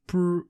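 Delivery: a quick high lip-buzz raspberry; fart noise. Retold or imitated. Player mimicking the character's fart with a raspberry. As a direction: blow a short raspberry for the fart.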